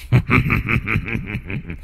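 sinister drawn-out laugh; eerie demon cackle. A narrator's reading. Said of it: "Bọn quỷ cười lên sằng sặc ghê rợn".

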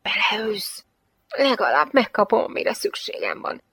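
whispery, hissed taunt; hissing. Acted. Girl hisses down at the man below, hissing taunt. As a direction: hiss it through the dark.

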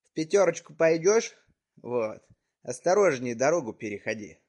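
ordinary talk, nothing marked

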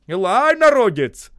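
high, loud rallying shout; calling out. A narrator's reading. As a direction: hurry the men along. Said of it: "покрикивая".

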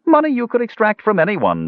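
high-pitched dwarf sales patter; rambling dwarf. A narrator's reading. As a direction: prattle on in a high voice. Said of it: "the dwarf rambled on".

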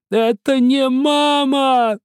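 piercing scream. A narrator's reading. Yani wriggling in Misha's grip, piercing high scream. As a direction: shriek it out while struggling. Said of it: "снова пронзительно закричал Яни".

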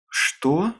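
startled question, rising high; mild shocked gasp of a question; breathed-out question. A narrator's reading. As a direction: let the word out in surprise. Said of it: "выдохнула Аманда".

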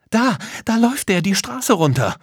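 high, loud excited cry; sudden high-pitched shout of discovery; excited alarm. A narrator's reading. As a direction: call out the sighting urgently. Child spots the fleeing magician.

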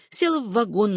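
plain reading voice

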